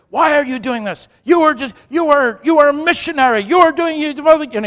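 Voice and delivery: loud, high-pitched angry accusation; imitated screaming tirade, loud and high; yelling and screaming. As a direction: yell it as an outraged heckler. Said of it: "he's yelling and screaming at me".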